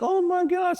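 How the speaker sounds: high mock-swooning girl voice; gushing girls. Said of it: "the girls are like".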